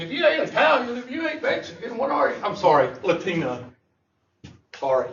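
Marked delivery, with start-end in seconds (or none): 0.0-2.4 higher, half-shouted mock demand; people's nosy question. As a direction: mimic the nosy askers, pitched up. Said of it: "I always get that question".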